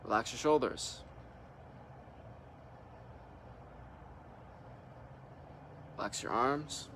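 calm meditation leader leaving room to breathe; guided meditation. Real, not acted. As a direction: guide them into relaxing, unhurried, one short instruction at a time. Leads a guided relaxation meditation.

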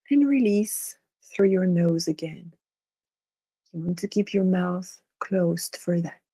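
unhurried meditation guide, long pauses between phrases; guided meditation. Real, not acted. Guiding listeners to relax and imagine, guided meditation. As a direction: lead them gently through it, leaving space after each instruction.

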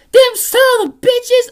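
put-on high, sharp old lady yell; grandma yelling. Grandma yelling at the Mets on TV, high and shrill. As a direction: shriek it like an outraged grandma at the TV.

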